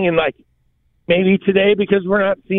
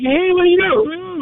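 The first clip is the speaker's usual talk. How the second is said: very high, put-on protest; high mock voice; 10 semitones higher than usual. Man objecting to being pulled down, high mock voice. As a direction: play the man before his voice drops. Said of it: "their voice gets deeper".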